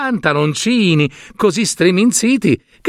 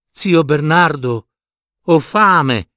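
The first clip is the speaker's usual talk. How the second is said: plain reading voice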